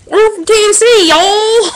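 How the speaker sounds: loud Tennessee twang; Southern accent. Pretend. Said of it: "Strange accent".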